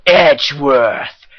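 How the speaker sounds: slow, drawn-out dramatic name call. Acted. Player relishes announcing the rival prosecutor, slow and dramatic. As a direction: call the name like a courtroom objection. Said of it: "I missed saying that too".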